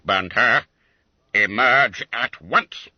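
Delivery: loud, slow stern order; stern command. Acted. Master ordering the boy out, loud and slow. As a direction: drag the words out like a threat.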